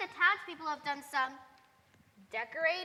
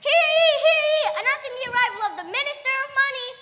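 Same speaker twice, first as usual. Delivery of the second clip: high herald announcement, fast; town crier; 7 semitones higher, 9 dB louder than usual. Acted.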